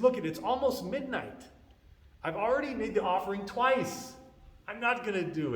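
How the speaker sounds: high-pitched, fed-up protest; exasperated refusal. Retold or imitated. Brahmana refuses to cook near midnight, exasperated protest. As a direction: protest with the pitch pushed well up.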